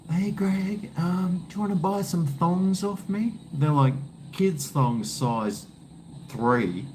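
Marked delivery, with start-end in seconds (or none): 0.1-3.3 put-on much higher kid voice; kid neighbour. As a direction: imitate the boy selling thongs at the door. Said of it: "He comes up, knocks on my door".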